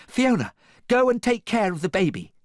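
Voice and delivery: high, loud little voice; small hero's squeaky command; piping bravely. A narrator's reading. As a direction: pipe up with brave urgency. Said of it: "piped Gingy bravely".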